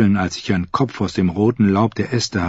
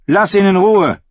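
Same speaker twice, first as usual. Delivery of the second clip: loud, high order; commanding; 10 semitones higher, 5 dB louder than usual. A narrator's reading. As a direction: command him to stop. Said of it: "befahl Brienne". (laut befehlend)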